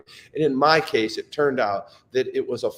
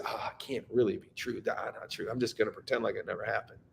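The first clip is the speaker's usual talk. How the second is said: softer, brushing it off with a shrug; dismissive denial; 3 semitones lower, 9 dB quieter, faster than usual. Retold or imitated. Grieving father waving off bad news, soft dismissive denial. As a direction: wave it away quietly, half to yourself.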